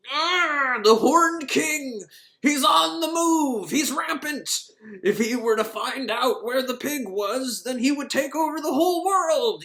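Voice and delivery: high, loud, slow frantic ravings; hammed-up doom-crying old man; ranting old man. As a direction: shout paranoid warnings at empty walls. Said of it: "He's just kind of shouting into the air".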